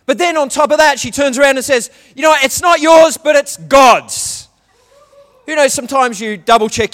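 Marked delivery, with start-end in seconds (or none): 2.1-4.5 loud, rising declaration, the last words slowed right down; fiancée's shock reveal, hammed up loud; mock announcement. Retold fiancée's twist, shouted.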